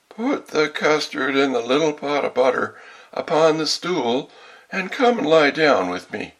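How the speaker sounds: high, loud mock-granny wolf; wolf posing as grandmother. A narrator's reading. Wolf coaxing the girl into bed, fake granny voice. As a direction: coax in a put-on high granny voice.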